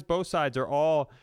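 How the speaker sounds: normal talking voice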